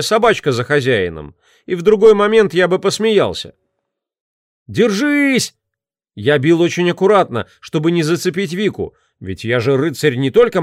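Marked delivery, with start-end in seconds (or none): 4.7-5.5 calling out. Acted. Calling out mid-battle.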